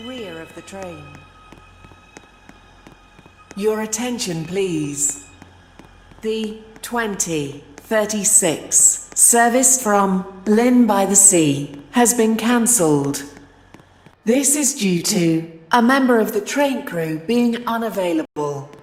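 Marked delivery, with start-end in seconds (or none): none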